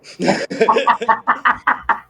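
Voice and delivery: loud high burst of laughter; hearty laugh at his own joke; big laugh. Real, not acted. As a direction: let a real laugh break out. Bursting laugh after Barbie joke.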